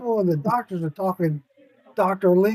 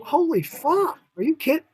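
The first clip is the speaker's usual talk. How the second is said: amazed disbelief; 9 semitones higher than usual. Retold or imitated. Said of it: "I was looking at it going".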